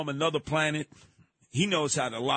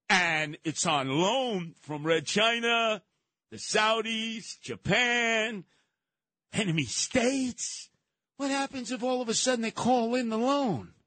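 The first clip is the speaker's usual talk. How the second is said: slow, building higher; rising alarm; 6 semitones higher than usual. Real, not acted.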